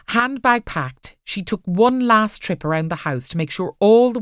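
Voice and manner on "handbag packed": usual speaking voice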